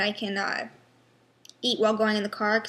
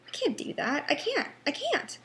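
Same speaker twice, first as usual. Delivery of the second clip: rising distress; 5 semitones higher than usual. Real, not acted. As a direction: pitch climbing higher with each I can't. Distress over facing college.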